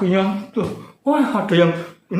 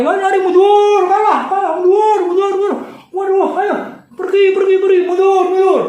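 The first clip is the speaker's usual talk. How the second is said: very high, loud frantic calls to flee; panicked retreat shouts; 5 dB louder than usual. Acted. Defeated Amalekites shout to each other to retreat, loud and panicked. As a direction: yell to your side to run away.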